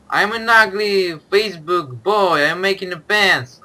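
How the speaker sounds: high, loud goofy boast; silly loud voice. Pretend. Silly toilet joking in game.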